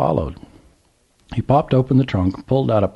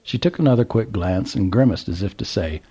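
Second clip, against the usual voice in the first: ordinary delivery, nothing marked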